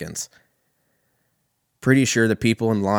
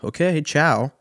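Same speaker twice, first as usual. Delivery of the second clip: higher, affected ciao from a show-off traveller; pretentious ciao; 7 semitones higher, slower than usual. Retold or imitated. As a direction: toss off the ciao with a hammed-up airy flourish. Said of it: "she hits you with the".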